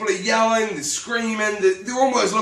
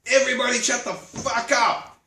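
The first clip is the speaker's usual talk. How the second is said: raised, slightly harsh shout over the crowd; retold yell; 6 semitones higher than usual. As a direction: cut through the din with a sharp yell. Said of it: "walk into the center of the room and yell".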